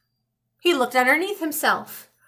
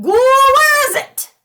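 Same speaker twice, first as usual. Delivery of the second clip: very high, loud yell at the ghoul; hollered demand; 13 semitones higher, 10 dB louder than usual. Acted. Old man hollers at the ghoul for the pumpkin, very high and loud. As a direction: holler it down from the air.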